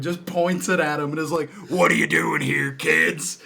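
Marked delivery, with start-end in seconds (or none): 1.7-3.4 lightly acted gruff challenge to intruders; mock confrontation, slightly raised voice; armed property owner. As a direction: imitate a man confronting trespassing kids, a bit louder. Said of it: "the dude walks out with a revolver".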